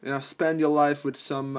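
ordinary delivery

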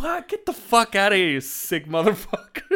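hammed-up disgusted yell; mock yell. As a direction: shout him down in jest. Teasing friend loudly.

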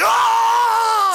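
high, loud hammed-up cry of delight; mock ecstatic cry. Guy recalling the sex scene, hammed-up cry. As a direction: yelp like the scene blew his mind. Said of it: "And I was like".

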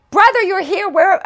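high, loud, overjoyed welcome; excited greeting. Retold or imitated. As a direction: greet him like a long-lost brother. Villagers greet the long-awaited visitor.

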